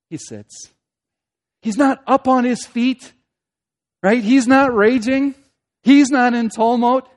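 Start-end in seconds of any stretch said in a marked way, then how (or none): 1.6-7.1 loud, high insistence; agitated emphasis. Shown as a demonstration. Preacher denying that God is agitated or raging, loud emphasis. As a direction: raise the voice as if in uproar. Acting the turmoil God avoids.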